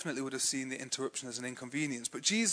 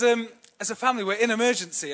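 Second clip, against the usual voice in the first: ordinary delivery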